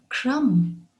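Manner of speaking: crumb ending on an M sound; silent B. Shown as a demonstration. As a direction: leave the B out of "crumb". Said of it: "but leave it out".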